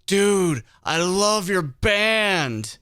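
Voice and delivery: high, slow, gushing dude voice. Retold or imitated. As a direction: stretch it out like a stoned rocker fan. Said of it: "He shakes my hand and goes".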